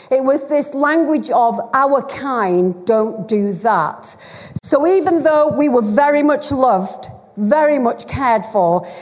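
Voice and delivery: ordinary talk, nothing marked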